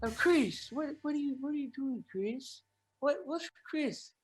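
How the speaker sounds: soft, lightly voiced Michael; hushed and gentle as Michael; [name] impression. Chris imitating Michael's soft voice. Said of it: "But Michael, I would go".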